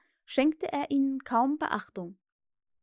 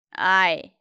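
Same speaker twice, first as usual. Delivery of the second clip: low, loud drawn-out "Ei"; startled groan; 4 semitones lower, 9 dB louder than usual. A narrator's reading. Hook flinches at talk of Peter, startled groan. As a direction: flinch and let it out heavily. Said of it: "Hook zuckte zusammen".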